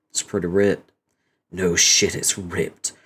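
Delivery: usual speaking voice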